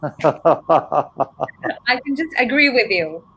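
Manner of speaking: slow words broken by a real laugh. Real, not acted. Joking she cannot teach him.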